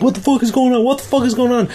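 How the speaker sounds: high, shocked disbelief; high-pitched freaked-out repeat; stunned repeat. Retold or imitated. Friend stunned in the corner.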